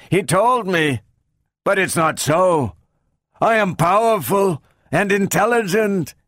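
high, halting robot speech; harsh robot, in spurts. A narrator's reading. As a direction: force the words out in bursts. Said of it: "The words came in spurts".

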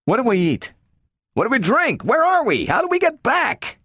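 high-pitched volley of questions; agitated tirade. A narrator's reading. Donovan rails about being stranded aboard, agitated tirade. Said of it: "interrupted Donovan's tirade".

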